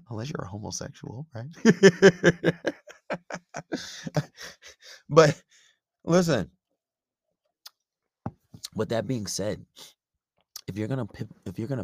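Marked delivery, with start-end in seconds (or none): 0.0-6.5 chuckling through the words; laughing. Real, not acted. Host cracking himself up over his homosexual joke, laughing. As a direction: laugh it out at your own joke.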